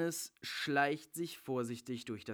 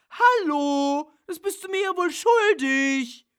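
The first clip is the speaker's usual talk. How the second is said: much higher and louder, shouting in outrage; indignant actress screaming for her part; furious yelling; 12 semitones higher, 16 dB louder, slower than usual. Acted. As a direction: yell at him like you've been betrayed. Bella insists he owes her.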